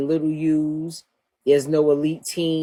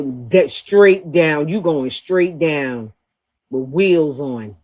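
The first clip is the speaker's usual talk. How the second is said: slow mocking drag; much slower than usual. Real, not acted. Mocking rival's aging.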